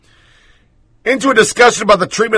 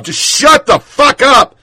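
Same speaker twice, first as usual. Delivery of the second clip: higher, louder slow exasperated yell; angry outburst; 9 semitones higher, 5 dB louder, slower than usual. Real, not acted. Host fed up with the tweeter, angry exasperated yell. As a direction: blow up at her, drawn out.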